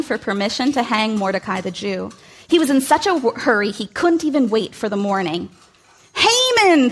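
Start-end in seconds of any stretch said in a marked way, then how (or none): 6.1-6.9 booming high shout of a name; king thunders Haman's name; thundered. Acted. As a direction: thunder the name across the hall. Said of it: "thundered the king".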